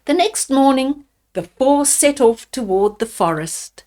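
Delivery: ordinary delivery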